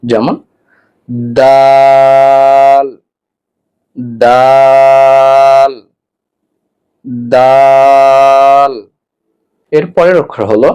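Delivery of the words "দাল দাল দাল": "দাল" drawn out very long, louder, on one note; tongue tip at upper tooth roots, then stretch "দাল"; loud, level, sustained "দাল"; Arabic letter demonstration. Shown as a demonstration. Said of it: "তায়ের মতো করে".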